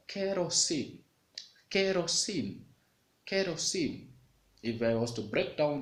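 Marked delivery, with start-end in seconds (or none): 0.1-4.0 kerosene said higher, three even beats; demo word kerosene. Shown as a demonstration. Teacher showing a three syllable word, raised and clear. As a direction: sound out kerosene as ke-ro-sene. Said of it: "If I have another word".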